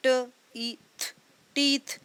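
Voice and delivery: each letter sound said apart. Shown as a demonstration. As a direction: split the word into its separate sounds.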